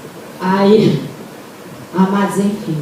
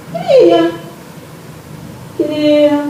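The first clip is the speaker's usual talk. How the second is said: high, drawn-out acted answer; eager phone reply; 6 semitones higher, much slower than usual. Speaker replays her call to a cleaner, eager high-pitched reply. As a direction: stretch it out on a raised pitch.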